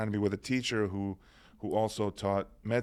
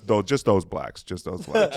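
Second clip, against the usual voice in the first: imitating announcer; 8 dB louder, slower than usual. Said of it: "And he goes".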